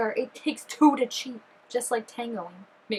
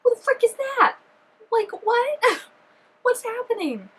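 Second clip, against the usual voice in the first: high, loud, disbelieving yelp; can't believe what she's seeing; shocked outburst; 12 semitones higher, 4 dB louder than usual. Real, not acted. Stranger appears, shocked outburst.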